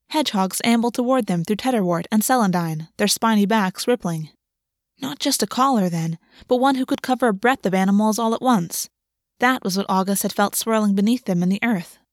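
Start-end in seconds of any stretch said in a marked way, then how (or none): none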